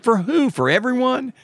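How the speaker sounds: high, disbelieving questions; can't believe it applies to everyone; incredulous. Retold or imitated. To the bank clerk about the limit, high incredulous questions.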